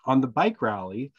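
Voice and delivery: ordinary delivery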